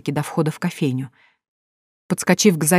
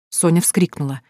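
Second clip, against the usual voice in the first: ordinary delivery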